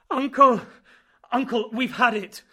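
very loud high panicked scream. Acted. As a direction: cry out that all is lost. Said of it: "What is this new panic?".

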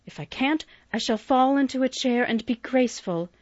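pouting youngest sister refusing to faint; young Amy, petulant. A narrator's reading. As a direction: complain like a proud youngest girl. Amy's voice held in reading.

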